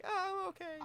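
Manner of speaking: very high put-on voice; Laura. Game master voicing an unhurt party member, high put-on voice. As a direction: squeak it out as a mock character voice. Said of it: "She's like".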